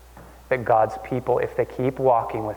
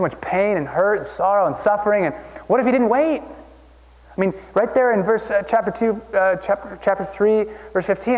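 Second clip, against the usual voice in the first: fervent preaching; 7 semitones higher than usual. Acted. Pastor pleading with the congregation, impassioned preaching. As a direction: preach it with rising fire.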